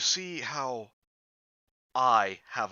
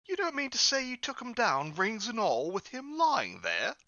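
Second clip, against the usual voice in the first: put-on pitched way up, querying old man; old fence Joe, raised-up wondering voice; high old Joe voice; 10 semitones higher than usual.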